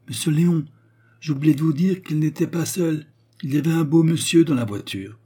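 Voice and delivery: put-on high, loud old concierge; calling after him. A narrator's reading. Concierge shouts after Léon on the stairs, high loud voice. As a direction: call the last bit of gossip upstairs.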